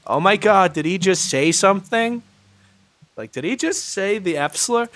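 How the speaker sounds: a hint of appalled disbelief; lightly voiced Karen. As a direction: imitate an offended audience member, lightly. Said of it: "like, the Karens echoing".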